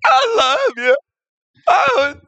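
very high, strained lovelorn wail; hammed-up heartbroken cry; anguished pleading. Dumped husband pleading with absent wife.

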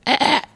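very high strained squeak; high failed dolphin cry; attempted dolphin squeal. Shown as a demonstration. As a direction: reach for a dolphin squeal and miss.

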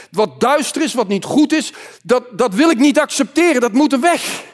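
driving, emphatic sermon delivery building in pitch; impassioned preaching. Acted. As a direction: preach it forcefully, rising toward a firm refusal. Preacher at full force.

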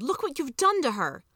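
an accusing yell, acted with some restraint. A narrator's reading. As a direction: cry out in accusation, pitched well up. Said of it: "turned to the commander and yelled".

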